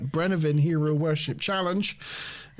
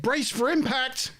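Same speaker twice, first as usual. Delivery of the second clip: higher, slow mock alarm call; hammed-up starship captain; 8 semitones higher, slower than usual. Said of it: "Maybe make it dramatic".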